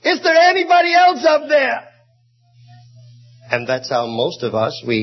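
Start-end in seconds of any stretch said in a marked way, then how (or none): 0.1-1.8 high, loud desperate shout; frightened man shouting for another rescuer; panicked yell. Acted. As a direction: yell it out much higher and louder. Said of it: "the tourist yelled".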